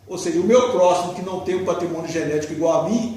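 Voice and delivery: ordinary talk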